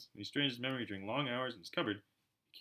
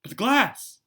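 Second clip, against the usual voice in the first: much higher, loud stunned cry; shocked; 13 semitones higher, 14 dB louder, slower than usual. Acted. The shocked keeper cries out about the glass. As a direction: gasp out in disbelief.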